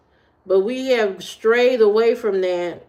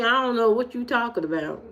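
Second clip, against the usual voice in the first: ordinary delivery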